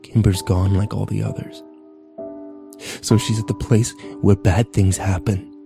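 low, subdued despair; struggling for composure. A narrator's reading. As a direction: speak low, collecting yourself. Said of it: "as Kyle tried to collect himself".

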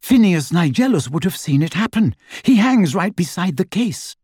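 puffed from effort but pressing the point; mildly out of breath; panting. A narrator's reading. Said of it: "she panted".

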